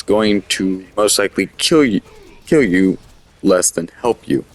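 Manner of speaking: speaking through hiccups; words broken by hiccups. Real, not acted. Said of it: "Sorry, I got the hiccups".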